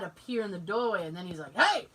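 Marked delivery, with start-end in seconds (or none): 1.6-1.9 sudden mock yell, pitch jumping; imitated dad's yell. Dad in the doorway to noisy kids, mock yell. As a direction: bark it like a fed-up dad. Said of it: "My dad doesn't yell".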